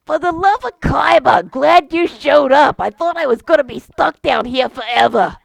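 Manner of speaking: put-on old Boston guy, relieved to see company; Bostonian accent. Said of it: "the beholder with a Bostonian accent".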